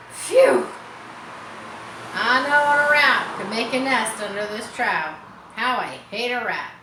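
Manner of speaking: loud disgusted outburst; disgusted phew. Acted. Farmhand recoiling from the stink, loud and disgusted. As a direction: blurt it out, repelled by the stench.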